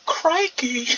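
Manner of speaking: high-pitched mock Australian exclamation; imitated Australian accent. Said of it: "just 'cause of her accent".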